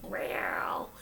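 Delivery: low, tiny whispered meow; a feeble kitten meow; Simba's little voice. Retold or imitated. Speaker mocks her own weak growl as a tiny whispered meow. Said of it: "I have Simba's little voice".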